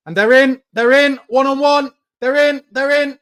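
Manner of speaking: high, loud panicked cry; alarmed shout. Real, not acted. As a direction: yell in alarm as they break through. Opponents break through alarm.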